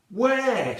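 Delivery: high, loud cry; shouted question. A narrator's reading.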